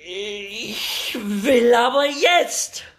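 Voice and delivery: shrill, slow, very high tantrum scream; child demanding at the top of her lungs. Acted. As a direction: scream it like a furious little girl. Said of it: "Deswegen muss sie jetzt leider losschreien".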